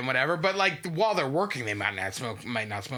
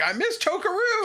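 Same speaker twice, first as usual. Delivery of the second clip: high, raised wail of missing someone; acted returning character; 4 dB louder than usual. Host imagining the costumed character's return, high mock lament. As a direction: play him coming back and calling out, pitched way up. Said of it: "come back and be like".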